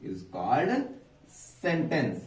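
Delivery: drawn out, word by word; slow dictation. Shown as a demonstration. Teacher repeating the definition slowly for the class. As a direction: read it slowly for them to copy. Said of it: "अच्छा देखिए".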